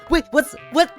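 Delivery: ordinary talk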